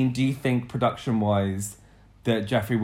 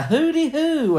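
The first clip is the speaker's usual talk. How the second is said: very high camp hoot; mincing camp; 13 semitones higher, 4 dB louder, slower than usual. Acted. Host mocks a contestant mincing in, camp. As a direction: send up a mincing queen with a swooping hoot.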